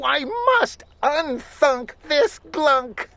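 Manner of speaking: put-on high, fearful girl's voice; frightened. A narrator's reading. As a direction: fret in rising panic. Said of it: "My sister shook with fright".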